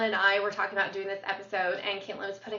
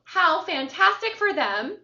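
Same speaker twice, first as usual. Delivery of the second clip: sarcastic; 7 semitones higher, 5 dB louder than usual. Acted. Mocking the twins' easy luck.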